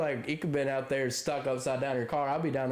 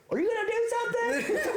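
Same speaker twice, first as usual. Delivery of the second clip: much higher, whining squeak with a laugh; mock whiny lady. Neighbor nagging her husband, high mock whine. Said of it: "She goes".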